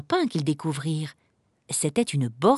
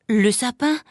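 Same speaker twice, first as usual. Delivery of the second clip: startled, higher self-reproach; sudden realisation; 5 semitones higher, 3 dB louder than usual. Acted. Shopper suddenly remembering the Christmas tree, surprised. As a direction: catch yourself having forgotten something.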